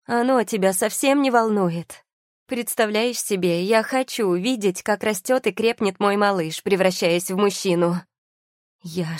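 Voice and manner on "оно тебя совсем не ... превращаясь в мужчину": high-pitched, impassioned reproach; crying out. A narrator's reading. Тия reproaches husband over her stolen future, crying out. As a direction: plead and accuse at a raised pitch. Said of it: "прокричала Тия".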